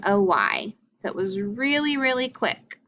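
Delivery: usual speaking voice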